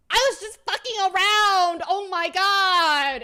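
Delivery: very loud, high, drawn-out wail; mock exasperated yell. Streamer acts out the friend's exasperated confession, yelling. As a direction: stretch the last words into a shout. Retold quarrel, exasperated outburst.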